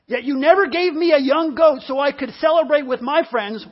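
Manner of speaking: acted-out aggrieved outburst; indignant complaint. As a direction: whine it out forcefully, like a wronged son. Brother's complaint to his father.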